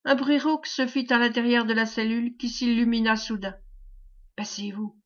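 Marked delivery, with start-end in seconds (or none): 4.4-5.0 a much softer, hushed warning; murmured. A narrator's reading. Aubry warning Reine to duck, hushed murmur. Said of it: "murmura Aubry".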